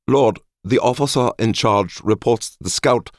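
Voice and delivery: high-pitched, formal officer's report; high voice of an embarrassed subordinate; embarrassed underling. A narrator's reading. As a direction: report to your lord, high and awkward.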